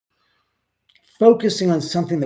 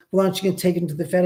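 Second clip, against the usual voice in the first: quoting a friend; 4 semitones higher, much faster than usual. Retold or imitated. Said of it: "she goes".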